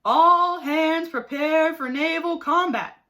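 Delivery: bellowed. A narrator's reading. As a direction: bellow the order to the whole crew. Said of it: "bellowed Blathersby".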